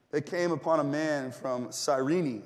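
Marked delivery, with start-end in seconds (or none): 1.7-2.4 "Cyrene" said as sy-REE-nee; three-syllable Cyrene. Shown as a demonstration. Preacher shows congregation how it is pronounced, "Cyrene" as sy-REE-nee. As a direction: end "Cyrene" with an audible ee sound. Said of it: "is actually how you pronounce it".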